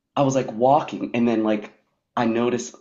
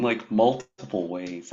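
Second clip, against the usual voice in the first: ordinary delivery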